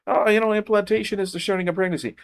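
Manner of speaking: high, sneering parody of their claim; mocking quote. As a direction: mimic the opponents with scorn. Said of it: "they say".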